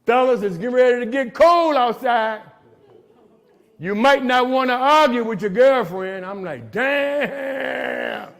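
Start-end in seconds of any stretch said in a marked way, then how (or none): 0.1-6.2 mock sassy warning, pitched higher and louder; quoting a woman's post. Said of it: "You know what she said?". 6.7-8.3 high, startled damn; a hurt yelp at the news; mock shock. Reacting to the low blow.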